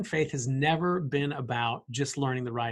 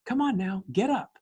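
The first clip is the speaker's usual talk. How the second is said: higher, tender coaxing: Sweetie, come on now; 5 semitones higher than usual. Retold or imitated. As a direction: gently coax a child to get up. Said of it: "Jesus is saying".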